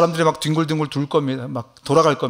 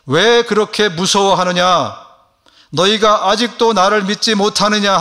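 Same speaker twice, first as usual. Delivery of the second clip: higher, louder, slow rebuke; Jesus rebuking; 5 semitones higher, 5 dB louder than usual. Acted. Jesus rebuking frightened disciples in the storm, slow and loud. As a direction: let Jesus ask it sternly and slowly. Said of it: "예수님은 제자들에게".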